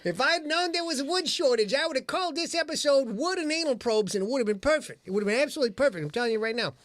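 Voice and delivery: high-pitched, emphatic lament; mock exasperation. Host bemoans the missed episode title, mock exasperation.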